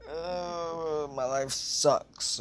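slow, droning "my life sucks"; weird mopey voice. Retold or imitated. Recalling the intro bit, mock mopey drawl. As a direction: drag it out like a bored teen. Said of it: "I did that weird".

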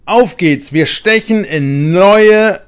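loud, rallying cheer; rally the team off on adventure; excited call. A narrator's reading. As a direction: Steffi cheering the group onward. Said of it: "ruft Steffi".

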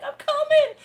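very high, loud eager reply; friend answering. Retold or imitated. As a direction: answer the call brightly. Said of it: "and she's like".

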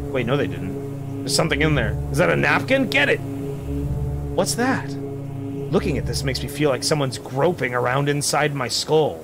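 normal talking voice

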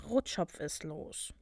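low, slow, very soft warning; slow undertone, barely voiced; murmured. A narrator's reading. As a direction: mutter it under your breath. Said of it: "murmelte sie".

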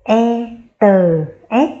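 "E tờ S" spelled out very slowly, each part drawn apart; teacher slowly spells "E tờ S" for the class; slow spelling. Shown as a demonstration. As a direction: stretch out "E", "tờ", "S" for the children to follow. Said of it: "Chúng ta đánh vần lại một lần nữa nhé".

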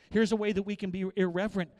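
usual speaking voice

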